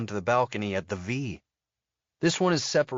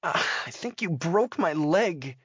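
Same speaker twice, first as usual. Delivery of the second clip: 1 groaning woman; 7 semitones higher than usual. A narrator's reading. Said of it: "She groans".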